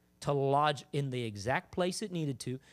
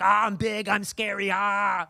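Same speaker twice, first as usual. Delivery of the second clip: mock scary demon; 3 semitones higher, 5 dB louder, slower than usual. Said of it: "the demon's all like".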